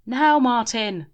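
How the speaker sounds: loud, slow bellow. A narrator's reading. Grim bellowing at Martin to go, slow and loud. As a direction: bellow the command. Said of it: "bellowed Grim".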